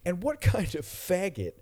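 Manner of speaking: drawn-out, high-pitched mimicked jeer; mock movie character. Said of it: "I'm gonna do the voice again".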